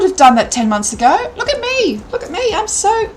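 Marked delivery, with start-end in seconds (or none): 1.4-3.1 excited. Real, not acted. Proud of herself on camera.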